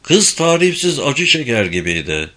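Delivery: plain reading voice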